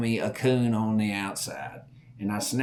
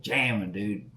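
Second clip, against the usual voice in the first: ordinary delivery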